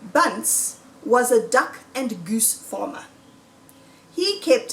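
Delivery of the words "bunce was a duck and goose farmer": ordinary delivery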